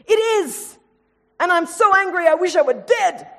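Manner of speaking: put-on high, loud childish outburst; sulking child yells back at the parent, furious and high; angry child. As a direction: shout it like a stomping child.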